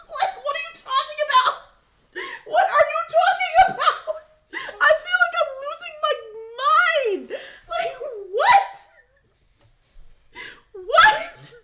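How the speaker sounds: laughing incredulous shout. Real, not acted. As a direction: cry out that you're losing your mind. Laughing disbelief at the video.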